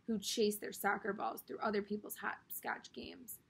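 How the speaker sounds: plain reading voice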